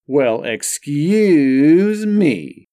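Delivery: sarcastic, slow excuse me; mock offense. A narrator's reading. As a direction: stretch it out, fake wounded.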